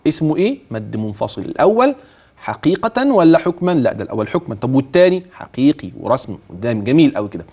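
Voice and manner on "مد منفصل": ordinary talk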